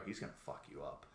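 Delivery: hushed whisper; whispered warning. Retold or imitated. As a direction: lean in and whisper the threat.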